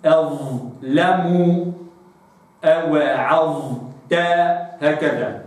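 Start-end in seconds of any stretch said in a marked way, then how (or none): 0.0-4.1 أظلم أوعظت recited very slowly; slow recitation. Shown as a demonstration. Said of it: "تأتي الضاء ساكنة في وصل الكلام".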